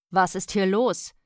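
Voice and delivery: loud, stern question; demanding. Acted. Father interrupting from the background, demanding.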